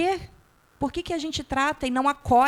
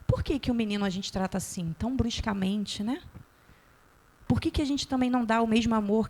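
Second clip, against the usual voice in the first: ordinary delivery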